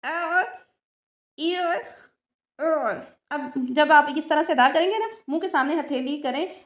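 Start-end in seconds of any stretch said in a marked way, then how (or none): 0.0-2.9 अ ई उ throaty, not drawn out; ain vowels demo. Shown as a demonstration. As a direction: keep the throat sound short and tight. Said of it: "दरमियानी कैफियत". (अ ई उ हलक से, लंबा न करके)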